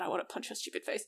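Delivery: fast angry whisper under her breath; whispered fury. Real, not acted. Friend vents about a film character in a fast angry whisper. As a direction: hiss the threat quickly under your breath.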